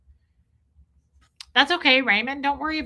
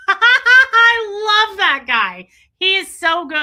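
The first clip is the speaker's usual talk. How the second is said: high, loud laugh-filled gush; real delighted laughter breaking through; laughing hard; 11 semitones higher, 11 dB louder, slower than usual. Real, not acted.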